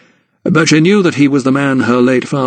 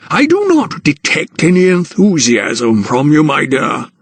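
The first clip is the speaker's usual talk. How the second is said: a hint of an old gentleman, higher and slow in places; lightly voiced patronising guardian; Sir Montague, lightly voiced; 4 semitones higher than usual. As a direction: narrator lightly playing a condescending old aristocrat.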